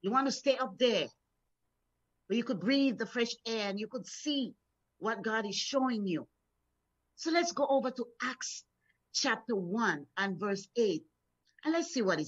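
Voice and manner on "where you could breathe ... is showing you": ordinary talk, nothing marked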